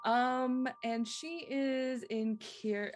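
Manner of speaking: usual speaking voice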